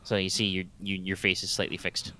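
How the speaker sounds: ordinary talk, nothing marked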